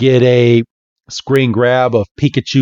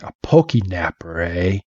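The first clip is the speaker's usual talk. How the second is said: low suspicious voice; 4 semitones lower, 5 dB quieter than usual. Retold or imitated. Red suspicious.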